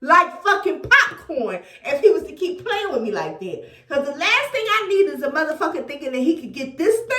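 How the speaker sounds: normal talking voice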